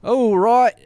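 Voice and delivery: loud, high comic Cockney call; put-on Cockney accent. Host wrapping up the show, loud mock Cockney accent. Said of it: "Now you do it Australian Cockney".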